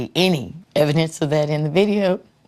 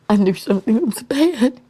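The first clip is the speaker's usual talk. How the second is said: raised, pained voice; emotional outburst; 7 semitones higher, 5 dB louder than usual. Real, not acted. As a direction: voice climbing with grief. Mother recalls dread, voice rising.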